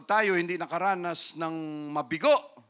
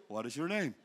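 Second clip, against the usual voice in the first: suave man; 6 semitones lower, 6 dB quieter than usual. Acted. Handsome man voiced in story.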